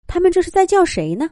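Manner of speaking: ordinary delivery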